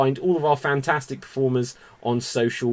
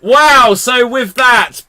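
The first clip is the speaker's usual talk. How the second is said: excited exclamation; 7 semitones higher, 8 dB louder than usual. Real, not acted. Host reacts to sacrifice.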